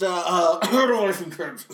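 garbled words buried in throat-clearing; stall, then slur it past the listener; evasive mumble. Retold or imitated. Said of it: "I didn't quite get that".

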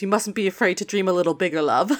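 ordinary delivery

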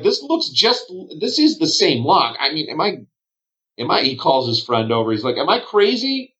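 high, confused innkeeper; can't believe the lock fails; baffled bartender. Acted. Bartender asking his friend about the lock, baffled.

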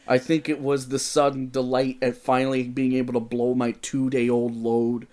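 ordinary talk, nothing marked

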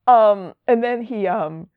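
ordinary talk, nothing marked

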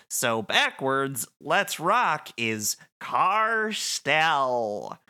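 very slow, drawn-out explaining; backwards speech. Retold or imitated. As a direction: spell it out painfully slowly. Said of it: "And he's like".

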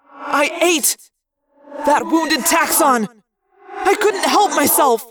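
high, loud, tormented creature; anguished outburst. Acted. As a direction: cry it out as a desperate confession. Wounded creature's anguished outburst and confession.

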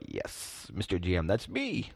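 soft drawn-in yes, then lifted "that's me"; savoring respect. Acted. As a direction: playfully relish being addressed with respect. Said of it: "it makes you feel like".

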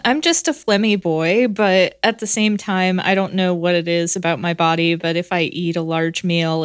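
usual speaking voice

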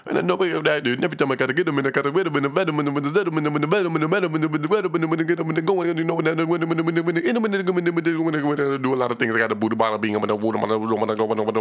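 high, rapid mock rap gibberish. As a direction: rattle off nonsense like a rapid rapper. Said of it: "people only know [name]".

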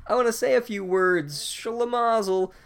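put-on high, slowed-down old wizard mumble; doddering Mumblecore. Headmaster rising to address the students, slow and doddering. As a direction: drag the words out like a befuddled headmaster. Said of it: "Mumblecore gets up there and he's like".

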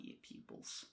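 put-on breathy whispered aside in a joking accent; a tiny whispered Australian jab; whispered mock accent. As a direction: hiss it under your breath. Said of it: "Australian accent".

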